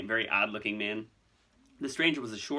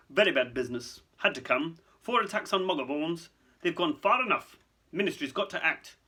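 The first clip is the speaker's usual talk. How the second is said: clipped, higher official voice; short, businesslike bursts; 6 semitones higher, 3 dB louder than usual. Acted. Fudge justifying the Ministry acting, clipped. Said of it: "in a rather clipped tone".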